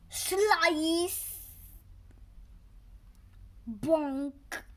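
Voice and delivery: plain reading voice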